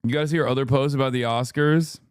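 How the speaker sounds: ordinary delivery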